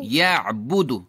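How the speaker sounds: یعبدو slow, throat tightened after the first vowel; squeezed ain. Shown as a demonstration. Teacher extends the drill like yani, یعبدو squeezed mid-throat. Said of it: "یعنی کی طرح".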